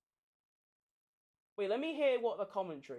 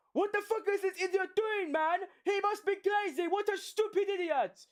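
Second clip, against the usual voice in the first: aggressive radio quote; 10 semitones higher than usual. Retold or imitated. Streamer voicing Perez's angry team radio, mock aggression. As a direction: spit it out like an angry racer on the radio. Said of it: "In that tone of aggression".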